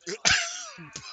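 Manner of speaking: high, loud laugh; burst of laughter. Real, not acted. Laughs at own story.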